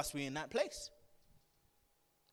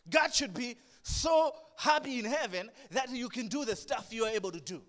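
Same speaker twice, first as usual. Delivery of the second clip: higher and louder, puffed-up mock praise; mock self-flattery; 4 semitones higher, 8 dB louder than usual. Preacher mocking a smug believer, higher, louder and puffed up.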